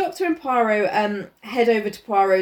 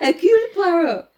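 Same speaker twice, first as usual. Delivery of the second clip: laughing Poirot; 8 semitones higher, 4 dB louder than usual. Pretend. Repeats name laughing.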